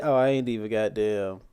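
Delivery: usual speaking voice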